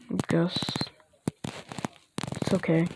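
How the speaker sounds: quiet. Acted. Hurt hero accepts apology.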